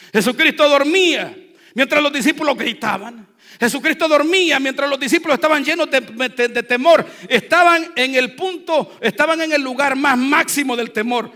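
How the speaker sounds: loud, driving preacher's cry; fiery preaching. Acted. Pastor preaching to the congregation, loud fiery delivery. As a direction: build the fear in the story.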